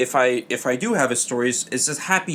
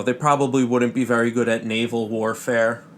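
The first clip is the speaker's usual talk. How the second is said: ordinary talk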